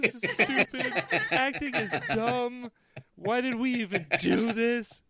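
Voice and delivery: high-pitched, laughing imitation of tearful complaining; mock crying. Actor retelling his post-scene breakdown, mock crying. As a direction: wail it in mock despair. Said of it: "I kept crying afterwards".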